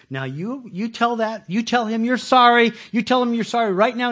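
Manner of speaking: put-on a parent making the child apologize; stern parent. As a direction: tell the child off. Parent voiced in story.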